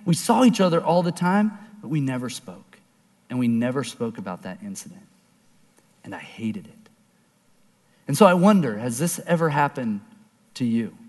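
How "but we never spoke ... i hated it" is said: quiet regret. Real, not acted. Regretful ending of story.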